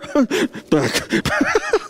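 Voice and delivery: high amused laugh. Real, not acted. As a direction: laugh at the joke. Laughing at his own joke.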